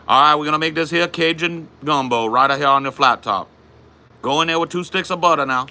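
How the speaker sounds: high-pitched Cajun cooking host; put-on Louisiana Cajun accent, pitched well up. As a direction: play a Cajun cook announcing the recipe. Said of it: "How's your Cajun accent so good?".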